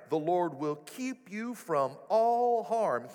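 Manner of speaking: ordinary talk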